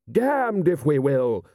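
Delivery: restrained narrated scream, high and slow; high, drawn-out outraged refusal. A narrator's reading. Lord refusing to withdraw, a contained scream. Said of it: "screamed Lord Rust".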